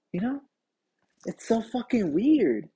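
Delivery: ordinary talk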